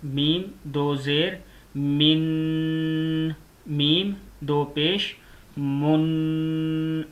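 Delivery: मिन and मुन stretched slowly, the n held humming in the nose; nasal ghunna. Shown as a demonstration. Teacher showing a child the ghunna, slow nasal drawn-out मुन. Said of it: "गुन्ना इसको कहते हैं".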